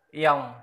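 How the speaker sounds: Ion said loud and clear; loud demo word. Shown as a demonstration. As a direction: say Ion for the learner. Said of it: "Bạn cứ đọc là". (đọc to rõ chữ Ion)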